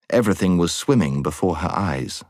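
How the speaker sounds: ordinary delivery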